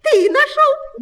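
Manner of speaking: very high, loud mouse shout; loud cry. A narrator's reading. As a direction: shriek it out as excited mice. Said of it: "громко закричали мышата".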